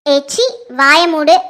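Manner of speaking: slow and low, each word pressed down; low cold command. Acted. Sanjana silences the aunt, low slow command. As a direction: shut her up with slow contempt.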